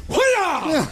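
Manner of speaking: high-pitched karate-chop battle cry; mock karate yell. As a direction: yell it like a hammy martial artist. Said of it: "he karate chops out of him and goes".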